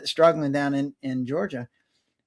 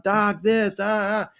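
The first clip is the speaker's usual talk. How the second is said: complaining patient; 4 semitones higher, slower than usual. Retold or imitated. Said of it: "everybody comes in with a problem going".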